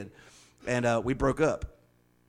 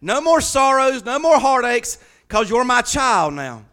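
high, loud, ringing preacher's cry; rising preacher's cry of comfort; full-force preaching; 10 semitones higher, 7 dB louder than usual. Acted. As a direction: lift it like an altar call.

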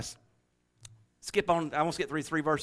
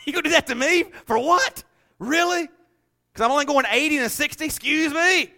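higher, sassy, incredulous retorts; mock indignation; 8 semitones higher, 5 dB louder than usual. Preacher imitating his own road rage, mock indignant. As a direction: play the offended driver, half-joking.

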